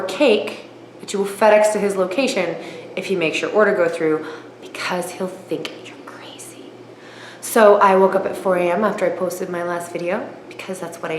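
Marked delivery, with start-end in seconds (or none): 4.6-6.7 breathy conspiratorial whisper; hushed punchline whisper; whispered aside. Real, not acted. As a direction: drop to a secret whisper. Aside about the phone call.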